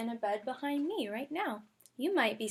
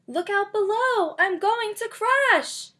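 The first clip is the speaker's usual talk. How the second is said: much higher, loud alarmed call; falling cry; 10 semitones higher, 11 dB louder, slower than usual. Acted. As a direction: shout a warning as you tumble down. Character falling in the story.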